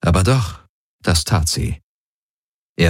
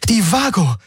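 high cry of sudden idea; high-pitched eureka cry; excited exclamation; 13 semitones higher than usual. A narrator's reading. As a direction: call it out as it strikes you. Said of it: "rief Kirai aus".

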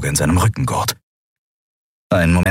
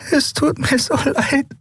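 a touch of tearful crying, higher. Acted. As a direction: apologize through mild tears. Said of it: "weinte Endriel". (leicht weinerlich)

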